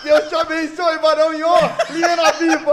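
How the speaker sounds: loud, high, laughing hype; excited cheer. Real, not acted. Host cheering the guest on the show, loud and laughing. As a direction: pump up the crowd with a laughing shout.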